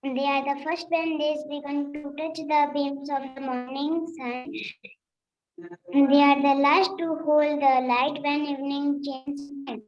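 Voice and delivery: measured schoolroom recital of a memorised verse; poem recitation. Real, not acted. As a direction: recite steadily, line by line, for the teacher. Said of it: "have a poem recitation".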